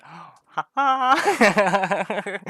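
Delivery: deadpan fake laugh; mock fake laugh. Imitating Ken's sarcastic laugh, mock. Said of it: "Ken just".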